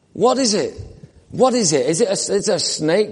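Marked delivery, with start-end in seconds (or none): none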